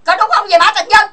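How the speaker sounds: shouting at a viewer to back her up; furious shout. Real, not acted. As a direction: yell the question at him. Furious rant demanding agreement.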